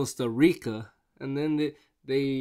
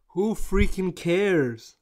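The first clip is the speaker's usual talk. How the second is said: slow, drawn-out "Who freaking cares?" with a louder push; exasperated; 5 semitones higher, 4 dB louder, much slower than usual. Retold or imitated. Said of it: "and I'm like".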